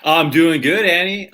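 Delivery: bright eager greeting; excited. Real, not acted. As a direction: greet her with a lift. Cheerful greeting.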